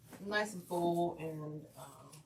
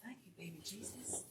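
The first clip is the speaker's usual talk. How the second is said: whispering; 15 dB quieter than usual. Real, not acted. Whispered aside to self.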